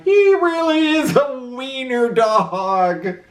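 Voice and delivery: high mocking cry; jeering dogs. A narrator's reading. Dogs mocking Oscar's costume, high and jeering. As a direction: laugh at him. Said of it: "they cried".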